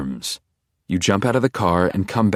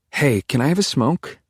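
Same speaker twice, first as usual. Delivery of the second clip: put-on higher-pitched young woman asking; daughter's plea; 7 semitones higher than usual. A narrator's reading. Daughter asking her father for a smoke, high-pitched plea. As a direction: wheedle it, high and rising. Daughter asks captain for cigarette.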